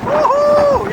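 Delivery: high whoop; joyful whoop. Real, not acted. Celebrating the rain.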